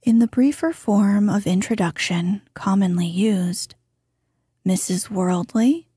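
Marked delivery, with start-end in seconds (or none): 4.6-5.9 "Worldly" lifted slightly at the end, like a question; rising inflection. Shown as a demonstration. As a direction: let "Worldly" rise as the more important name. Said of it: "said with a slightly rising inflection".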